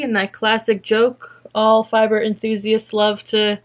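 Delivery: usual speaking voice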